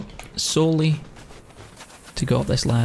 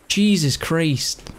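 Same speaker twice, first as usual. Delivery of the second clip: ordinary talk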